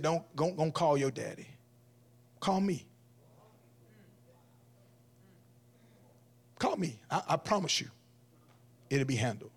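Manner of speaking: hushed, grave, with feeling; serious father's promise. Retold or imitated.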